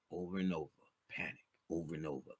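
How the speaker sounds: fading to a murmur, repeating; trailing quiet. Real, not acted. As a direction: let it sink away, almost to yourself. Repeating drawing name, trailing off.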